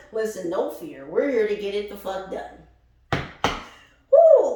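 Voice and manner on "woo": high, loud cheering whoop; a loud, high celebratory woo; excited woo. Real, not acted. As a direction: cheer them on with a big woo. Cheering the group on.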